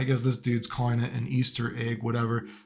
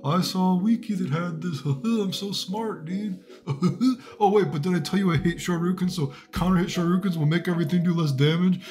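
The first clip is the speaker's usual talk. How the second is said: higher, smug know-it-all voice with laughs; mocking nerdy robot developer; 4 semitones higher than usual. Streamer mocks Street Fighter six designers, higher smug nerd voice. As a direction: play the game dev as a smug nerd showing off. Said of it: "the robot added in stuff like this to be like".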